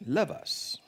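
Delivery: breathy whispered repeat of love us; whispered echo. Acted. Pastor echoing the curse line to the congregation in a whisper. Echoed phrase in recited curse.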